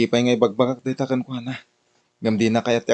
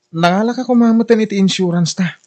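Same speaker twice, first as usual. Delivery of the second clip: high, louder pushy pitch; eager; 9 semitones higher, 5 dB louder than usual. Acted. Agent pushing insurance on the wife, eager and loud. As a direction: lean in and sell hard.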